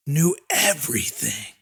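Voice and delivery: ordinary delivery, nothing marked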